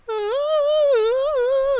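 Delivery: very high held squeal; high-pitched excited squeal. Retold or imitated. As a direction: squeal with glee in the cinema. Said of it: "I was like".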